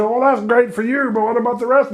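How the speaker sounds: a high, snippy sailor reply; sarcastic sailors. Acted. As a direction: throw it back at him, annoyed.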